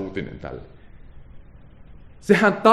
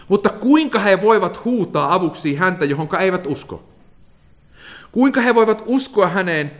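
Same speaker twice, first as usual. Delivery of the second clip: raised, driving rhetorical questions; fervent preaching; 6 semitones higher than usual. Acted. Preacher pressing the congregation with Paul's questions, fervent and rising. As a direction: build each question higher like a preacher.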